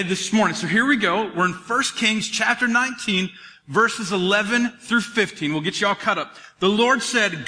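ordinary talk

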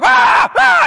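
high yelped ah ah; loud high mock yelp; mock kids yelling. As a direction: yelp like rowdy kids in the stands.

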